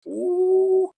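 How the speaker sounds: high, loud delighted ooh; excited ooh. Real, not acted. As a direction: swoon over a favorite player. Raving about Brian Dawkins.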